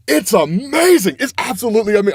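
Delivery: gush, can't hold it in; excited. Real, not acted. Vlogger gushing over the comic's big moment, excited. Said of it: "I'm so excited".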